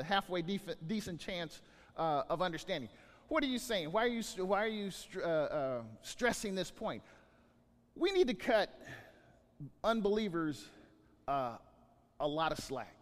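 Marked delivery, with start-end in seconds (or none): none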